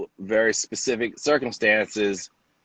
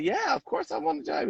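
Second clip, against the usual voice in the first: high, soft excited reply; can't believe the offer; breathless eager acceptance; eager yes; 6 dB quieter, faster than usual. Retold or imitated.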